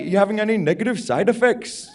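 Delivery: mock Indian doctor asking a question; Indian accent. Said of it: "to do the Indian accent".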